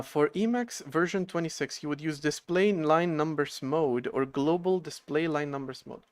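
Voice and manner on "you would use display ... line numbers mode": ordinary talk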